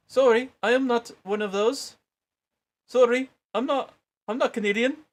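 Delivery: high, loud mock Canadian accent; bad Canadian accent. Peter pretending, denying in a bad Canadian accent. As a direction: fake a Canadian accent badly. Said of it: "that's a terrible accent".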